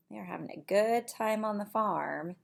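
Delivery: plain reading voice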